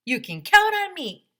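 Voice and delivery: high ringing hero shout; heroic shout. Acted. Custodian vowing to clean the mess, shouting high. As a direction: belt it like a superhero.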